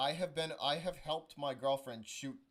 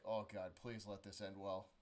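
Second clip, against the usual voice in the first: much lower and softer plea; muttered dread; 7 semitones lower, 8 dB quieter than usual. Retold or imitated. Boyfriend watching her confront strangers, soft low dread. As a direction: say it under your breath into your hand. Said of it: "my hand in my face".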